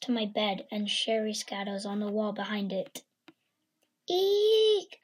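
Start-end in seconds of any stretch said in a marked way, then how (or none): 4.1-5.0 high startled shriek; a quick frightened squeal; scream. Acted. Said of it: "I screamed".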